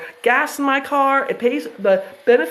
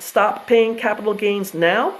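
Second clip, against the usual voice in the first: ordinary delivery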